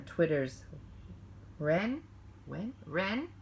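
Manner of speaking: slow, lilting bedtime-story narration; cozy picture-book reading, stretched and sing-song; storytelling read-aloud. A narrator's reading. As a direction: read it to children at bedtime, drawing out the lines.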